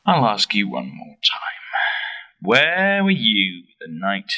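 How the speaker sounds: slow, loud, every word pressed home; detective pressing the widow, slow and loud; stern interrogation. Acted. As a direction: lean in and demand the answer. Detective presses the suspect hard.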